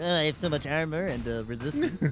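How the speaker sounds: lightly voiced nerdy know-it-all; a hint of a smug nerd; Dakota's nerdy voice. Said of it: "that's my Dakota's nerdy voice".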